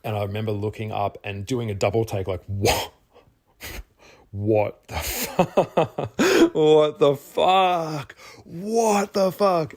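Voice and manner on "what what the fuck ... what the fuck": the mirror shows a face you can't believe, and it climbs into laughing shock; stunned disbelief. Retold or imitated. Alone at the mirror, staring at a changed face: rising disbelief.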